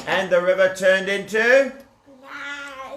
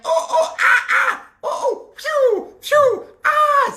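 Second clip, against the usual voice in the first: panicked yelps; slower than usual. Acted. Pharaoh hit by monkeys' bananas, high panicked yelps. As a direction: yelp as things fly at you. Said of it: "says Paro".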